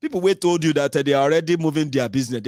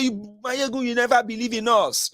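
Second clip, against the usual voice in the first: imitated fan; 9 semitones higher than usual. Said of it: "I say".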